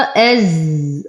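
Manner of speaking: very slow "أز", holding the buzz of the zay; تطويل الزاي الساكنة. Shown as a demonstration. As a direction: linger on the z of "أز". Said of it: "أصبر على الزاي لأنه ساكن". ("أز" مع الصبر على الزاي الساكنة)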